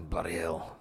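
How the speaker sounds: sighed, whispery and soft; soft exasperated aside, almost voiceless; weary muttering. Acted. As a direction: breathe it out under a sigh. Player sighs at vomiting scene.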